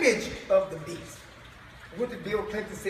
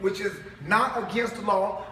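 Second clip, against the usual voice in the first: ordinary delivery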